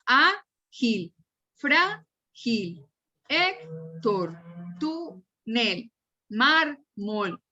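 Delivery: "Ágil, frágil, Héctor, túnel, mármol" split into syllables, first one stressed. Shown as a demonstration. Teacher separating syllables for students, "Ágil, frágil, Héctor, túnel, mármol" broken apart, first syllable stressed. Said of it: "hago separación silábica".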